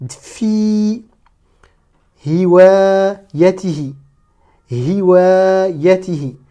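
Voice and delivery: slow, long vowels held; each word set apart for learners; stretched-out Arabic reading model; detached word-by-word. Shown as a demonstration. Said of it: "chaque mot de manière détachée".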